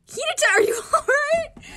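high, very loud shout breaking into a laugh; alarmed shout. Acted. A teammate shouting in alarm at Hinata, high and loud. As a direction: yell in panic, laughing through it.

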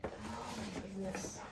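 ordinary talk, nothing marked

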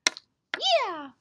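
high, loud puppet squeal; high little character voice; Snowball. Acted. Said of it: "Right, Snowball?".

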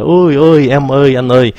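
calling out high to a waiter across the room; hammed-up hollering for service; mock shout of ôi, ôi, em ơi across a restaurant; mock loud calling. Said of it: "giơ tay lên mà la um sùm".

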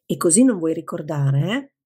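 usual speaking voice

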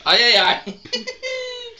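laughing through the ais; nervous laughter. Real, not acted. Friend laughing nervously as ferrets sniff her.

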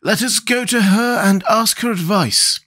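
high, slow character voice; Scarecrow's voice. A narrator's reading. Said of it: "said the Scarecrow".

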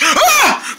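short high-pitched scream; startled scream. Acted. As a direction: cry out in sudden fright. Lights suddenly go off.

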